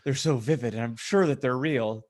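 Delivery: ordinary delivery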